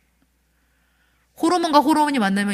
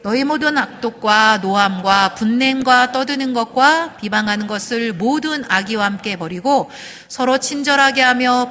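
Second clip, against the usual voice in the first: scripture reading; 2 semitones higher, 4 dB louder than usual. Acted. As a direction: read the verse aloud together with the church. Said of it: "성경 말씀 같이 읽습니다". (성경을 회중과 함께 크게 낭독)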